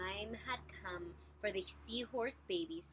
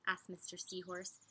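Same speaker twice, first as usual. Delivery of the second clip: usual speaking voice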